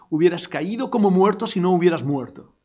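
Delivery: ordinary talk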